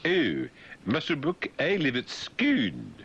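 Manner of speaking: lightly mocked posh local; posh local gushing. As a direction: gush like a proud local. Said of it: "people in Andover Valley say".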